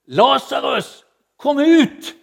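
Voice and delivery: loud, high commanding call into the tomb; a booming summons, slow on the name; Jesus calling out. Acted. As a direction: call Lazarus out of the grave with full power. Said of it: "så säger Jesus".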